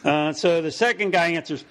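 usual speaking voice

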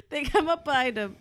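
normal talking voice

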